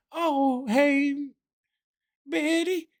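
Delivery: put-on very high, sugary voice greeting an old lady; mock sweet grandma greeting. Caller greeting grandma Betty on the phone, very high sweet voice. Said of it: "If I called a grandma and I was like".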